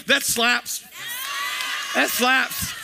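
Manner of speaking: mock church response, called out; enthusiastic call-out. Shown as a demonstration. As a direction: shout it like an amen. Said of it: "you need to go".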